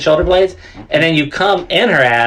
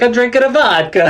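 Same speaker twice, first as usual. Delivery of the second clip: a higher, hammed-up Russian accent; bad Russian accent; 7 semitones higher than usual. Recalling a bit about a Russian guy, bad Russian accent. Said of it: "talking about a Russian guy".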